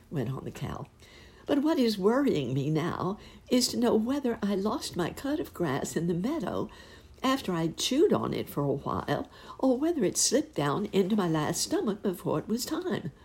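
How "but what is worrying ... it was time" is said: higher, fretting cow lady voice; worried cow. Acted. Cow lady worrying aloud to Uncle Wiggily.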